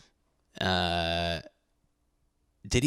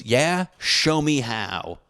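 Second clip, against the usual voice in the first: slow, higher, a touch of nerdy bravado; adult nerd comeback; 6 semitones higher, 4 dB louder, much slower than usual. Retold or imitated. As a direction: dare him, slowly and higher. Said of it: "in nerd fashion".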